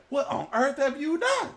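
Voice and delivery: high, loud disbelieving cry; frightened sailors. Acted. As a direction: shout it in panic at the runaway. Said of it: "And said".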